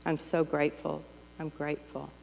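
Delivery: soft, fading grateful murmur. Retold or imitated. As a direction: let gratitude sink in softly. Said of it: "that's a good one".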